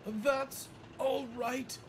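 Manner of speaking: high, slow, pained politeness; wincing, annoyed. A narrator's reading. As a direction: wince through it with annoyance. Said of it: "winced Annie".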